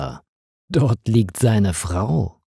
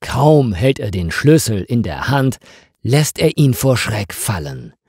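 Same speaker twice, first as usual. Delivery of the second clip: ordinary delivery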